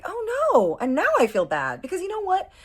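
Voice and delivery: very high, loud wail of regret; dramatic dismay. Retold or imitated. She realizes she flirted with a taken man, dramatic dismay. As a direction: wail it, hammed-up and guilty.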